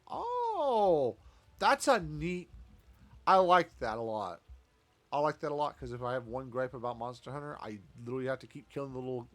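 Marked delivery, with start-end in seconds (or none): 0.1-1.1 sudden high delighted gasp; surprised. Real, not acted. Delighted by a game feature. 1.6-5.8 loud, eager praise; light up and gush about it; excited. Real, not acted. Reacting happily to fishing feature.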